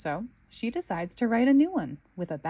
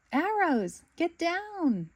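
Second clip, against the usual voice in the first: higher pitched playful yell; yelling warning; 10 semitones higher, much slower than usual. Acted. Bluey yelling to her friends to dodge pretend arrows, higher playful yell. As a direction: call the warning out to your friends. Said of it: "She yells to Snickers and Winton".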